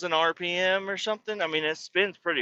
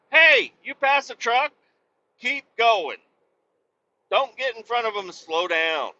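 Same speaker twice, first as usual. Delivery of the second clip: shouting at driver; 6 semitones higher than usual. Retold or imitated. Trucker yelling at the Sonata driver to keep going. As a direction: yell advice across the lanes. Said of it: "I wish I could talk to that motherfucker".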